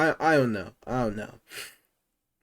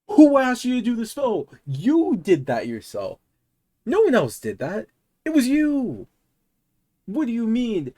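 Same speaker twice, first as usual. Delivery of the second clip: high, loud indignant rant; exasperated; 9 semitones higher, 4 dB louder than usual. Real, not acted. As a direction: call her out, fed up. Ranting at the scammer's excuse.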